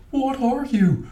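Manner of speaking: high, loud mock-dramatic question; acted film line. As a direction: demand it like a hammed-up film scene. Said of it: "half expected when he said".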